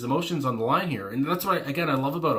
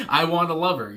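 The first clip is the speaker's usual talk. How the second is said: laughing through it; 5 semitones higher, 6 dB louder than usual. Real, not acted. Reads lyric while laughing.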